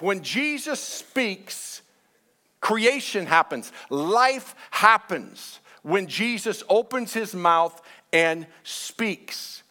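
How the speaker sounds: slow, weighty scripture proclamation; emphatic preaching. Real, not acted. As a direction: preach it slowly, pausing between phrases.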